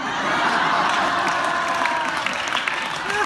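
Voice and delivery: loud, high, helpless laugh; big laughing burst. Real, not acted. She laughs hard at her own punchline, loud and high. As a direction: explode into laughter.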